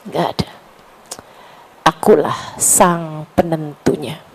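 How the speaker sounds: a hint of a solemn commanding voice; voice of God speaking. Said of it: "Allah kayak lagi mau ngasih tahu". (suara Allah yang ditirukan ringan, lebih rendah)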